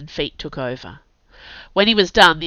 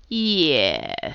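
long, drawn-out careless yeah; tipsy indifference; 3 semitones lower than usual. A narrator's reading. Kurt feigning drink to the bruiser, careless tipsy drawl. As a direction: stretch it out as if a few beers in. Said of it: "like he'd had a few beers and didn't really give".

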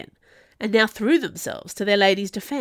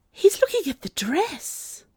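higher-pitched alarmed thought; rising panic; 9 semitones higher than usual. A narrator's reading. Velody watches the man eye the dress, panicked. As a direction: let the panic lift the voice. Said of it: "panic rose fully within her".

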